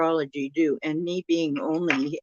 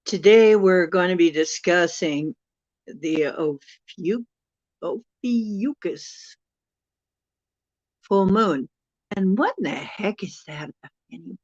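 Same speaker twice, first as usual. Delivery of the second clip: ordinary talk, nothing marked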